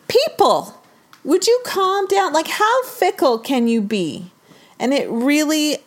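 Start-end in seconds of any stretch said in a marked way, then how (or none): 0.1-4.3 higher-pitched indignant appeal; exasperated rant. Acted. Baker defender addressing fickle fans, exasperated. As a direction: plead angrily with fickle people to calm down. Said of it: "really angers me".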